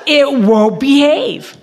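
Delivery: mock exasperation at the clerk; exasperated retort. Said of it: "I thought".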